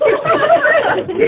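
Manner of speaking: short high laugh; quick laugh at the punchline. Real, not acted. Laughter after joke.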